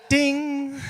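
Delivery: high, loud elevator ding; loud bell ding. Retold or imitated. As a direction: ring it out like a bright chime. Elevator bell imitated loudly.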